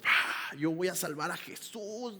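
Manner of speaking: a soft, slow, hushed boast of a know-it-all; mock heroic Peter. Preacher mocking Peter drawing his sword, hushed mock boast. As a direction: play it as a small, naive bragger.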